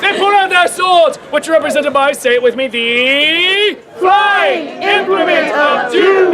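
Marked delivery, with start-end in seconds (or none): 2.7-6.3 hammed-up epic naming; dramatic announcer. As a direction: boom it out like a trailer voice. Dramatic naming, crowd joins in.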